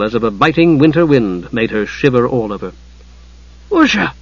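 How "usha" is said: high-pitched alarmed cry. A narrator's reading. Tas seeing Usha turn pale, alarmed. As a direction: cry out the name in concern. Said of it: "Tas was alarmed".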